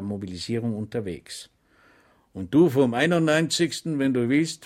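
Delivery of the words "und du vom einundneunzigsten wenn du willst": a lightly voiced deserter, a bit louder; deserters inviting. Deserters urging Schweig to join them, lightly voiced. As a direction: coax him to come along. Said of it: "forderten sie Schweig auf".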